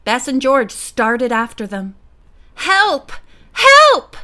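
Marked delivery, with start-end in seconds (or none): 2.5-4.2 high, loud cry, second one higher; scream for help. A narrator's reading. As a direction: scream urgently for rescue. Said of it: "came Nancy's scream again".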